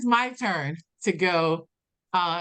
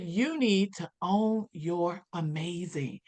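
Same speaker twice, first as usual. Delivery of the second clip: very slow, every word landing; slow, weighty advice; 3 dB quieter, much slower than usual. Retold or imitated. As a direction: deliver it slowly like a life-changing truth. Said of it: "She said".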